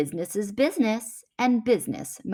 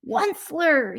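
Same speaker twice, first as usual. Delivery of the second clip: croaking cry; 8 semitones higher than usual. A narrator's reading. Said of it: "he cried with a cruffulous croak".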